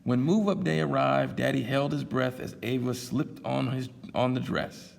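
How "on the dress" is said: ordinary delivery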